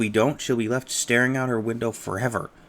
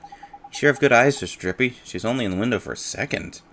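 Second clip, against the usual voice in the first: normal talking voice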